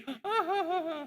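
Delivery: high mock cackle of the enemy; mock laugh. Enemy laughing at cards in the coffee stall, high mock laugh. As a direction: ham up his carefree laugh. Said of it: "Ketawa ke T V".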